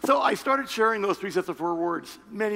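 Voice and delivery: usual speaking voice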